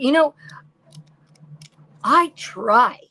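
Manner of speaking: ordinary talk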